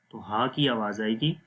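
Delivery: usual speaking voice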